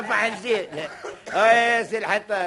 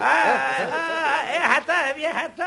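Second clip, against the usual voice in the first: crying out; 4 semitones higher than usual. Acted. Crying out during the scuffle.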